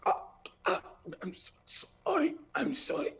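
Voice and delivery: high, breathless whispered whimper; terrified apology. Acted. Cowering man begs his dangerous mistress, high frightened whisper. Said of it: "And he just kinda".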